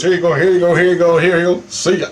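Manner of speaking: imitated hurried cop. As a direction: rush it out like a busy cop. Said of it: "He wrote everything down real fast".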